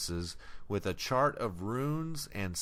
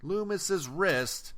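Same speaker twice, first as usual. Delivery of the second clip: normal talking voice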